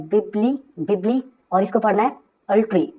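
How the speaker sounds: teacher sounding out the syllables; pronunciation demo. Shown as a demonstration. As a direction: break it into बिबली then अल्ट्री. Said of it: "अब इसको आपको पढ़ना कैसे है?".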